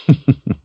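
short high villain's laugh; brief amused laugh at a threat; mocking chuckle. A narrator's reading.

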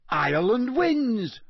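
loud, high, drawn-out announcer's cry; Bagman shouting. A narrator's reading. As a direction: proclaim the winner to a stadium. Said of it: "Bagman shouted".

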